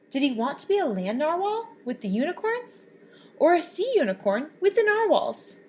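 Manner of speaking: plain reading voice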